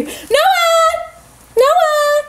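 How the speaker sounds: high calling across the house. Real, not acted. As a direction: call out to someone in another room. Calling brother in.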